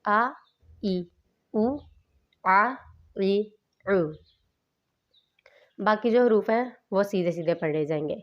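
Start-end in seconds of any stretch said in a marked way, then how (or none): 0.1-4.0 آ plain glottal, the second آ squeezed from the throat; ء against ع. Shown as a demonstration. Teacher contrasting hamza and ain for students, careful articulation. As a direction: press the ع from the throat. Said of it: "اس کا فرق اس طرح کریں گے".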